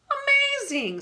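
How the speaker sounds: high, loud cry of wonder; amazed. A narrator's reading. Mister Willow hearing they share a ship, amazed. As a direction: gasp it out in wonder. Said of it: "his jaw dropping open in wonder".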